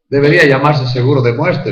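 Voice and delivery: ordinary delivery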